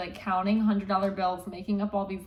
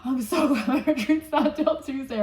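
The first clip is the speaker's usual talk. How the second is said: higher, laughing through the words; laughing mock relief; 5 semitones higher, 4 dB louder than usual. Repeats relieved remark laughing.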